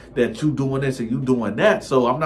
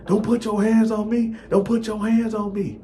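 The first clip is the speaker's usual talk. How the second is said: put-on girlfriend crying out; 11 semitones higher than usual. Girlfriend heard through the door.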